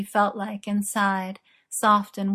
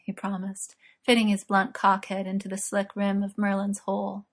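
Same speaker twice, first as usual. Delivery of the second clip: usual speaking voice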